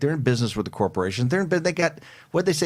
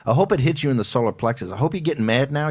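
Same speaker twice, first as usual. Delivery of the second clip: usual speaking voice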